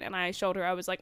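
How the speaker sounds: ordinary talk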